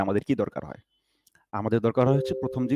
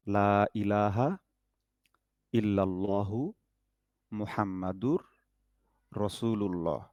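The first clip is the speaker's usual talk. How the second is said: slow, softer recitation for students to follow; 5 dB quieter, slower than usual. Shown as a demonstration. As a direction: recite it slowly so they can mouth along. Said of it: "আমি পাঁচ ছবার পড়তেছি". (ধীরে ধীরে তিলাওয়াত)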